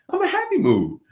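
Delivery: very high-pitched retold insistence; mock-innocent protest. Man answers partner accusing him of yelling, mock-innocent. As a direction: plead innocence way up high, playfully.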